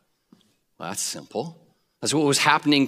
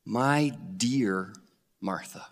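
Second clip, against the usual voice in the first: tender; 4 semitones lower, 6 dB quieter, much slower than usual. Retold or imitated. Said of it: "I hope you can hear the tone of voice".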